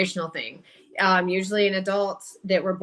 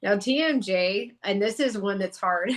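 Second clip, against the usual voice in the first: ordinary talk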